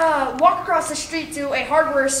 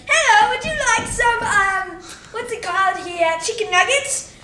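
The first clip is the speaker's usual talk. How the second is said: British clown voice; 6 semitones higher than usual. Retold or imitated. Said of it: "But you sound British".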